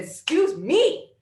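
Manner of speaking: loud high indignant yell; yelled excuse me. Retold or imitated. Friend says she feels ugly, so a shocked yell. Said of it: "I, like, yelled".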